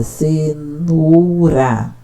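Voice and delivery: "cenoura" stretched out syllable by syllable, very slow. Shown as a demonstration. Teacher demonstrating a child's reading, slowly sounding out "cenoura". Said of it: "Cenoura tem três sílabas".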